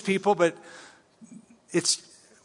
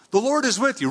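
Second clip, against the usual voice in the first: raised, doubting repeat of the promise; incredulous higher mock repeat; skeptical echo; 7 semitones higher, faster than usual. Shown as a demonstration. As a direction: quote it back as if you can't believe it.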